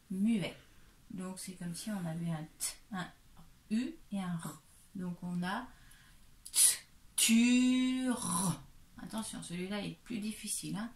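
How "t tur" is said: "t" alone, then "tur" loud and stretched; sounding out. Shown as a demonstration. Teacher shows three sounds, segmented and drawn out. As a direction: separate the "t", then hold "tur" long and high. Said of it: "Trois sons".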